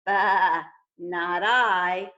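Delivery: a gruff sheep shouting no; loud sheep refusal. Acted.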